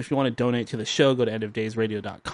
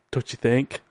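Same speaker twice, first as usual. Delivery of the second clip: ordinary talk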